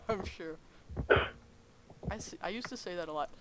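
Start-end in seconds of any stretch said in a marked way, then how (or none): none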